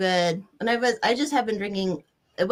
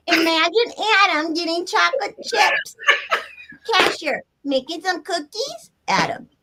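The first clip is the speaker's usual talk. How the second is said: lightly voiced loud goofy Randy, higher; hammed-up reading as a friend, pitched up; Randy's voice; 8 semitones higher, 4 dB louder than usual. Reading aloud to friends in Randy's goofy voice. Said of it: "I could read it in, in Randy's voice".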